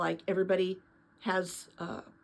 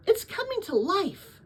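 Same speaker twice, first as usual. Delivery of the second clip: awed wonder; 11 semitones higher than usual. Acted. Lena sees bean sprout.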